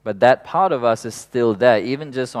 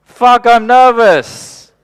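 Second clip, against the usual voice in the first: much higher, louder, slow panicked cry; hammed-up panicked outburst; mock panic; 13 semitones higher, 15 dB louder, much slower than usual. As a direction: panic at your own body. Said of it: "I'm going".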